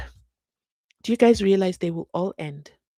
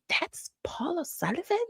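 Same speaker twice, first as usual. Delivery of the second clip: whispered disbelief; 9 semitones higher, 6 dB quieter than usual. Retold or imitated. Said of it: "And then I said".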